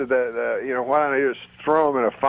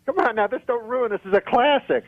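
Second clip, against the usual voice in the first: high, pleading comic exasperation; mock protest; 7 semitones higher than usual. Ventriloquist begging puppet to behave, high mock protest. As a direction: plead in a raised pitch, playing offended.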